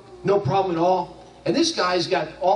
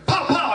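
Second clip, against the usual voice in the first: mock gunshots; 10 semitones higher than usual. Friend firing at the cow in the story, mock gunshots. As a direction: fake the two shots with your voice.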